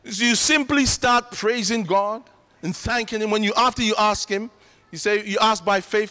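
ordinary talk

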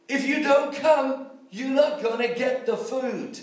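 ordinary talk, nothing marked

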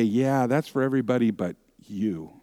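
a hint of a tempter whispering doubt; sly liar voice. Inner liar tempting the doubting believer, soft and sly. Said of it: "that liar voice in you say".

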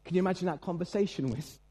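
ordinary delivery

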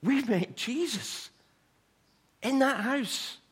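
high and slow with wonder; excited report. Retold or imitated. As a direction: announce the miracle, amazed. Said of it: "they come bursting saying".

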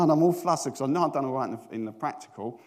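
ordinary talk, nothing marked

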